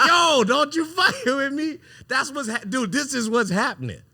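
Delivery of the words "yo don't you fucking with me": high, loud hammed-up movie yell; Matt Damon impression. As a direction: shout it like the movie scene, overdone. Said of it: "like Matt Damon and Good Will Hunting".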